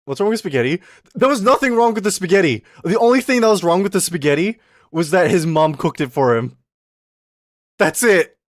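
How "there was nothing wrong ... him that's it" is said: higher, louder emphatic rant; exasperated rant. Real, not acted. Streamer defending the spaghetti to chat, loud exasperation. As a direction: hammer the point home.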